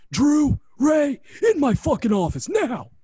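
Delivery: angry boss summoning two wrestlers; boss yelling. Retold or imitated. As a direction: bellow them into the office. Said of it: "and he says".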